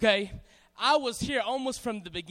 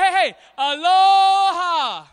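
high, very loud drawn-out call; rallying shout; 6 semitones higher, 15 dB louder, much slower than usual. Real, not acted. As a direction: holler a greeting to the whole crowd. Rallying crowd with greeting call.

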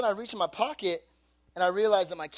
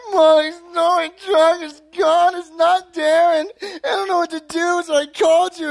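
imitated crying; 10 semitones higher, 9 dB louder than usual. Son telling mom truck is gone, mock bawling. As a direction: blubber helplessly, hammed up. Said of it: "But I'm crying".

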